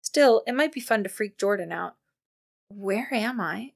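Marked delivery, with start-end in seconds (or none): 2.7-3.7 slow, softer, faking a dazed daze. Pretend. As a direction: play dazed and lost, slowly. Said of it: "she said in a dazed voice".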